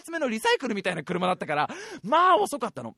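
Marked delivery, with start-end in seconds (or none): none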